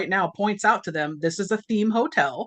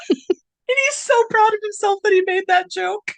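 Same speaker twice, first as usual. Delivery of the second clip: high, loud, laugh-choked voice; real laughing fit; 12 semitones higher, 5 dB louder than usual. Real, not acted. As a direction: squeal it out through helpless laughter. Cracking up at the joke.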